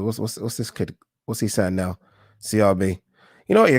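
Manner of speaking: usual speaking voice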